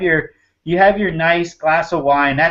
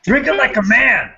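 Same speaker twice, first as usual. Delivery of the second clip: gruff dad voice; 6 semitones higher, 4 dB louder than usual. Retold or imitated. Mock old-school dad line.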